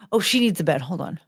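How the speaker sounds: normal talking voice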